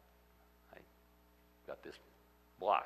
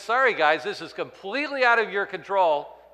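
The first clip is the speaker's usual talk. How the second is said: loud, higher no-apology retort; emphatic retort; 9 semitones higher, 12 dB louder than usual. Acted. Jesus telling the Pharisees it's out of control, loud emphatic retort. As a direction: tell them flatly it's beyond them.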